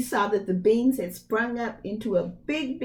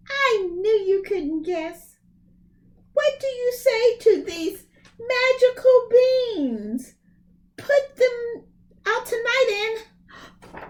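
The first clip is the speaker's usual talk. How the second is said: put-on high, rising boastful boy; excited high Jack; 14 semitones higher, 5 dB louder than usual. Jack showing his mother the magic beans, high and excited. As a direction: show off the beans proudly.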